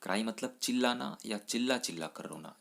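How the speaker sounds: ordinary delivery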